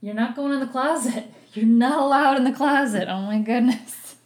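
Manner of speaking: high-pitched, laughing telling-off; real exasperated amusement; amused scolding. Real, not acted. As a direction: scold a pet through laughter.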